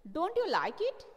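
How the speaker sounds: sudden high, loud surprised question. Acted. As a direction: sound caught off guard, as if puzzled. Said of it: "said all the creatures in surprise".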